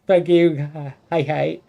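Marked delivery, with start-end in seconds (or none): none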